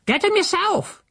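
very high, snappy retort; housekeeper flashing back. Acted. Housekeeper to Silas on her way out with the bucket, very high snappy retort. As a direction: fling it over your shoulder. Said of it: "she flashed".

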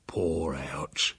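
low, quiet, whispery; conspiratorial hush. A narrator's reading. Uncle winks at nephew once house is silent, hushed. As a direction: say it as a sly aside.

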